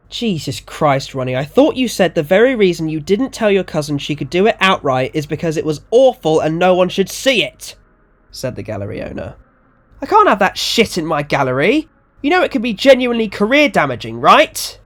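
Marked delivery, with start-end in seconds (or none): 0.1-7.8 mildly exasperated, higher and louder; exasperated objection. Acted. Gallery owner objecting to the cousin's exhibition, exasperated. 10.0-14.8 higher, louder, agitated protest; alarmed objection. Acted. Gallery owner warning Ronnie about career damage, alarmed.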